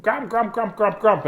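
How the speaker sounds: high, repetitive grumbling noises; cartoonish rude grumbling; mock grumpy outburst. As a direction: imitate your own cranky snapping back, hammed up. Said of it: "and I just went".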